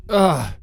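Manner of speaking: loud, high "ugh"; startled cry. Acted. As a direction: cry out suddenly, high and falling. Narrator reacting as ritual erupts.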